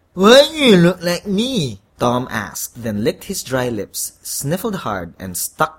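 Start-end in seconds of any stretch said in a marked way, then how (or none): 0.1-1.8 higher, louder congested voice; stuffy-nosed question. A narrator's reading. Congested man asks the devil why they look alike, stuffy-nosed. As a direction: ask it through a blocked nose. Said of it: "with a stuffy nose".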